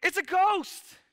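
high, loud cry of alarm; hammed-up frightened yell, pitched high; mock scared scream. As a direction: cry out as if you've spotted something terrifying. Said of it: "they were all screaming, scared for their lives".

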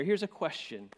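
ordinary talk, nothing marked

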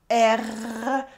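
long rolled "R" from the back of the throat; throaty French R. Shown as a demonstration. Teacher demonstrating the French R, long throaty trill. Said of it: "from the back of the throat".

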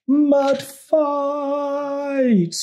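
high, far-off squeal of the crowd; excited cry. Acted. Narrator voicing the frogs calling mud fight, excited high shout. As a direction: shriek it like gleeful little creatures.

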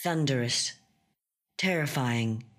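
ordinary delivery

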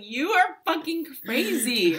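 loud, high, drawn-out exclamation; mock exasperated yell. Partner retelling their reply to a sudden apology, mock exasperated yell. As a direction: stretch it out loudly in mock outrage.

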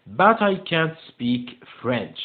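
very slow, word-by-word English; slow model reading. Shown as a demonstration. Teacher showing pupils the answer in the text, slow model reading. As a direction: give each word its own space.

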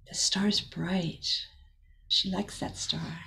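softly whispered, slow; whispered aside. Real, not acted. Reader to child at bedtime, whispering.

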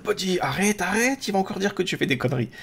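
high teasing scold to the dog; playful scolding. Real, not acted. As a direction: tell the pet off, half laughing.

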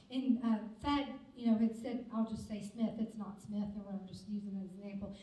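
ordinary talk, nothing marked

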